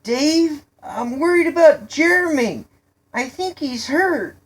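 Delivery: put-on high, loud boyish plea; worried calling out. A narrator's reading. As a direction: play on his sympathy from afar. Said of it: "tried to play off David's sensitive side".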